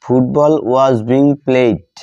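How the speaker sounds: teacher dictating while writing. Shown as a demonstration. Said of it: "আমি নিচে লিখি".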